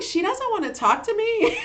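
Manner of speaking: high-pitched playful indignation; mock hurt. Daughter to nurse on being put off, mock hurt.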